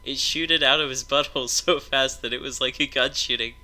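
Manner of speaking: ordinary talk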